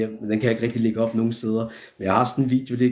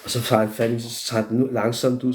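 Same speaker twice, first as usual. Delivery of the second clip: ordinary delivery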